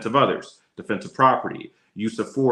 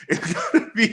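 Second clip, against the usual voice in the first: laughing; 8 semitones higher than usual. Real, not acted. Laughs while repeating the rule.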